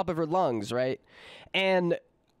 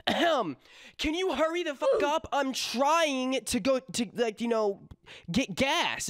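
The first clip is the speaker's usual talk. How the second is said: mock Karen yelling; 6 semitones higher than usual. Narrator imitates Karen snapping at the cashier, high and mocking. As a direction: sneer it like an impatient customer. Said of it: "When I say yelling, she's like".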